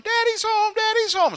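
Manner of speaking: put-on very high, squealing child's cheer; excited kids. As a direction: cheer like a happy little kid. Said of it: "instead of the kids going".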